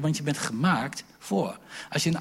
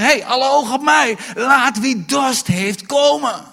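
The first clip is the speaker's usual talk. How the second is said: loud call; 11 semitones higher, 11 dB louder than usual. Acted. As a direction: demand every eye on you. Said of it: "Hij zei". (luide oproep aan de menigte)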